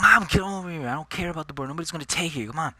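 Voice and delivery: loud, high call to mom; impatient shout for mom. Retold or imitated. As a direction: yell across the lot at mom. Calling mom through the door.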